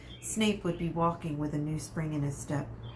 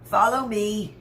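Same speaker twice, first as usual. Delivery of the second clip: Filch; 4 semitones higher, 9 dB louder, slower than usual. A narrator's reading. Filch voiced in story.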